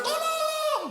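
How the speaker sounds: very high thin cry for help; falling woman screaming. Acted. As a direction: shriek as she falls again. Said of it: "Dia teriak".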